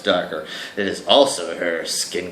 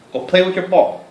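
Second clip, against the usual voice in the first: high, louder coaxing; higher pet-talk command; 11 semitones higher, 5 dB louder than usual. Real, not acted. Urging the dog to go play in high pet talk.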